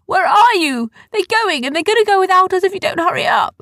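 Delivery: high, loud, urgent yelling; shouting. A narrator's reading. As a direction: shout a frantic warning to a friend. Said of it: "shouted Arthur".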